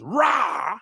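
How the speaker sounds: drawn-out British "rah"; British slang exclamation, stretched like a roar; British slang accent. Shown as a demonstration. Demonstrating the next practised word, British accent. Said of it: "the other one that we're working on".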